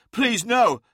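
loud high desperate plea; begging. Acted. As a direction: beg in panic. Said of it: "begged Hathor Mart".

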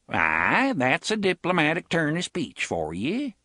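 put-on thick Magus accent, the vowels pulled wide; rustic Magus accent, rising and sly; broad Magus accent. A narrator's reading. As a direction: lean hard into the Magus accent. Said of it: "Jonas said in a broad Magus accent".

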